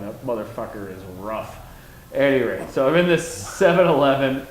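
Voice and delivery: ordinary talk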